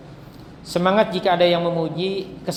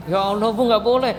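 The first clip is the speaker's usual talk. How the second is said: louder, higher exclamation of dismay; shocked scolding; 4 semitones higher, 5 dB louder than usual. Retold or imitated. Healer reacting to a mother cursing her husband, shocked scolding. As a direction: protest in half-amused alarm.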